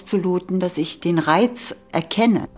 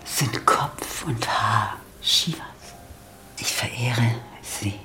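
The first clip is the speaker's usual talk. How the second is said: reverent worship; 7 semitones lower, 7 dB quieter than usual. Shown as a demonstration. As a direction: murmur it softly as a ritual vow. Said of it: "und jetzt verehrt die Frau den Mann". (andächtig geflüsterte Verehrung)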